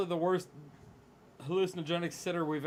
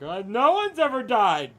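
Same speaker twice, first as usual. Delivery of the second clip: higher, louder slow know-it-all reassurance; hammed-up expert calm; mock expert; 8 semitones higher, 9 dB louder, slower than usual. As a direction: play a self-assured expert. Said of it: "like some expert and be like".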